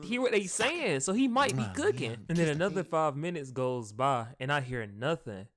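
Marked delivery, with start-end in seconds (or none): 0.0-2.2 much higher, anxious rush; high anxious confession; paranoid. Real, not acted. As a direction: get nervous and speed up. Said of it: "I got a little paranoid".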